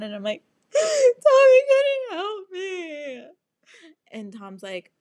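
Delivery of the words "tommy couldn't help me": very high, loud, slow wail; hammed-up crying. Crying call to Tom for help, hammed-up. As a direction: wail it through exaggerated sobs. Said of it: "cry. I call Tom again".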